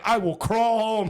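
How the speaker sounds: loud, high, drawn-out defiant wail; over-the-top stubborn declaration; mock threat. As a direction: stretch the vow out in hammed-up desperation. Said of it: "I was threatening".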